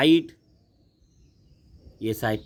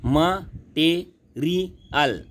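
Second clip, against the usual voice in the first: Material very slow and higher, four spaced beats; syllable by syllable; 5 semitones higher, much slower than usual. Shown as a demonstration. Teacher showing children four syllables, material split very slowly. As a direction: pause after every syllable of material. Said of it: "चार है बच्चों इसमें".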